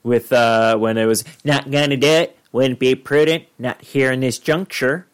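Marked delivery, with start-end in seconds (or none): 1.4-5.1 presidential impression. Well-known presidential catchphrase impression.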